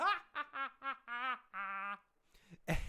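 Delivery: high mock evil cackle. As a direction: cackle like a killer doll. Chucky's laugh after quote.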